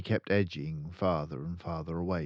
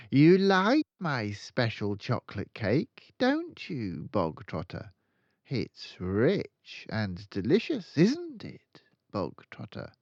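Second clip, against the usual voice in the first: slower, sugary menacing sweetness; mock friendly; 6 semitones higher, 6 dB louder, slower than usual. Headmistress feigning kindness to trap the boy, mock friendly. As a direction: coax him like a cat with a mouse. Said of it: "her voice was suddenly softer, quieter, more friendly".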